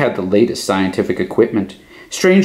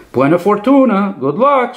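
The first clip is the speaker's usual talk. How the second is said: high call after him; 9 semitones higher than usual. A narrator's reading. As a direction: send good luck after him. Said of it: "Strega Nona called after him".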